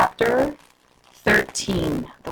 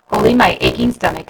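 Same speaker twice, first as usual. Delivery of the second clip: ordinary delivery, nothing marked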